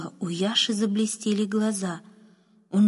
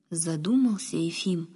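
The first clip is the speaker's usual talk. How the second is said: usual speaking voice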